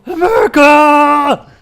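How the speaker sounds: huge, high, drawn-out war cry; battle cry. Pretend. Gamer yelling a patriotic cry mid-game, full bellow. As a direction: bellow it long at full volume.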